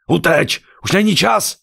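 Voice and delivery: yelled very high and loud; desperate shout. A narrator's reading. Husband to his wife before running out, desperate shout. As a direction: scream at her to flee now. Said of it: "zařval".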